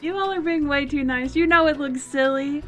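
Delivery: ordinary talk, nothing marked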